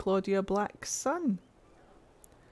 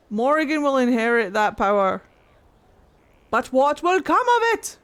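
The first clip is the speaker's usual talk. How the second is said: louder, rising to a high, loud question; excited; 5 semitones higher, 7 dB louder than usual. Real, not acted. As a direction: build up with eager lore excitement. Excited lore rant.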